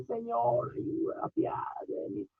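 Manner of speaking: high, soft, breathy cry of mercy; mother begging Jesus for her daughter, high and hushed; imitating pleading woman. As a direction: beg like a desperate mother. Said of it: "venía gritando atrás".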